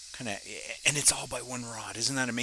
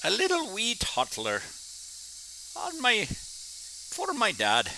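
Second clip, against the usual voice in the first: put-on a quick, playful stab at an Irish accent, pitched higher; lightly hammed-up Irish lilt; 9 semitones higher, slower than usual. Glassblower jokes about her childhood in a mock Irish accent. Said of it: "I shouldn't do an Irish accent".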